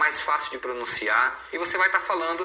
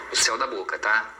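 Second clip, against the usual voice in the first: usual speaking voice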